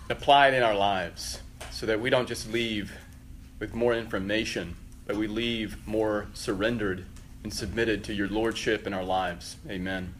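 soft, reverent prayer voice. Acted. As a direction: pray it quietly and earnestly. Leads a prayer.

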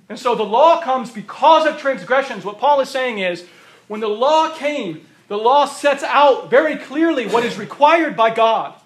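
loud, driving sermon delivery; fiery preacher pressing the congregation; preaching at full force. Acted. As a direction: pound the point home from the pulpit.

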